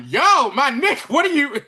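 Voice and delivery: high, loud mock panic; alarmed yell. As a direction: yell at the driver who just bailed out. Acted panic as driver jumps out.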